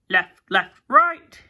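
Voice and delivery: loud gleeful drill shout; gleeful cry. A narrator's reading. Cyclops Fred drilling Marie Antoinette, gleeful loud shout. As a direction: bark the march like a delighted child soldier. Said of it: "he cried gleefully".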